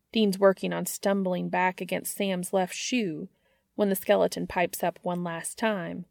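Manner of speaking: usual speaking voice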